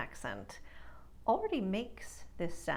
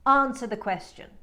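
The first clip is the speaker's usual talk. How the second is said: transatlantic Bette Davis; 4 semitones higher, 10 dB louder than usual. Shown as a demonstration. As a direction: Answer the question with a broad A and no R, loud. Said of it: "in transatlantic, she says".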